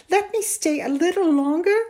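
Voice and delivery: put-on high, loud pleading boy; almost begging. A narrator's reading. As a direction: plead with mother, voice lifted. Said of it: "Amal was almost begging".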